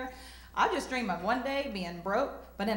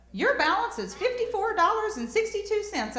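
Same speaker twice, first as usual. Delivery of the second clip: high, bright automated bank voice; bank lady; 11 semitones higher, 5 dB louder than usual. Retold or imitated. Comic imitating the bank's recorded balance message in a high voice. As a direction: mimic the bank's recorded voice, pitched way up. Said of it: "the lady's like".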